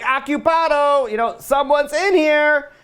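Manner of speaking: high, drawn-out call; mock calling out. Pharaoh warning someone away from his toilet, comic drawn-out call. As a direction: sing out slowly to warn off an intruder.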